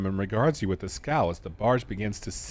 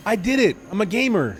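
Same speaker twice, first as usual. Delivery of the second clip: much higher, louder cheer of victory; real gamer celebration after a tough puzzle; excited triumph; 10 semitones higher, 6 dB louder than usual. Real, not acted. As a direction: shout it like you just won. Triumph after beating the task.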